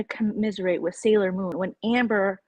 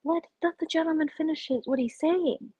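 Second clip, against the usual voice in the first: a bit higher, imploring; mildly hammed-up plea to let him finish; mock pleading; 8 semitones higher than usual. Telling the panel to let him speak, mock plea. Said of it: "And I'm just like".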